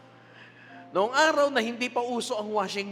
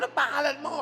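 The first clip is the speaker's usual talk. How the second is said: mock shouting teacher; 8 semitones higher, faster than usual. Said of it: "Sumisigaw talaga".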